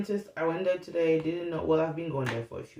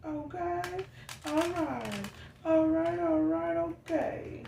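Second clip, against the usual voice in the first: high, playful all right; high sing-song all right, okay; 12 semitones higher, slower than usual. Real, not acted. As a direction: chirp it up high.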